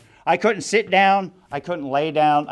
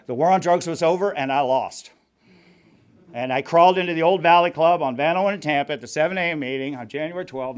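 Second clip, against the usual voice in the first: ordinary talk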